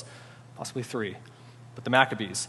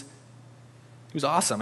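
drawn out; 5 dB quieter, much slower than usual. Acted. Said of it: "drawn out praise".

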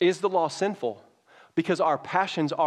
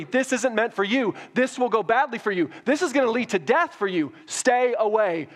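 raised, high, intense sermon voice; impassioned preaching; 7 semitones higher, 4 dB louder than usual. Acted. Pastor driving home Adam and Eve to the congregation, full-force preaching. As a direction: preach it hard to the room.

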